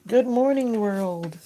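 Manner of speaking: high, loud sing-song hello to the day; cheery greeting. Real, not acted. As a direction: call it out brightly to the whole world. Greeting the morning outdoors.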